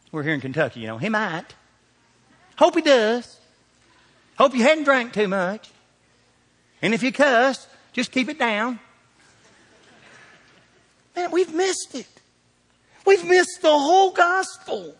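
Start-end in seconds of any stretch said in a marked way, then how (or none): 1.0-8.8 higher-pitched parody of a timid believer; mock doubtful. Preacher parodying Kentucky churchgoers who won't commit, mock doubtful. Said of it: "hearing people say it like this". 11.1-14.9 very high, straining exclamation; exclaiming. Real, not acted. Preacher lamenting that the church missed the gospel, high exclamation.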